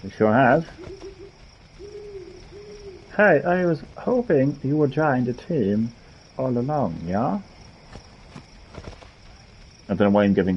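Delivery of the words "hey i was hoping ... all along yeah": put-on German accent, pitched higher. Voicing a character hoping someone joins the team, dodgy German accent. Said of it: "like, a German accent or something".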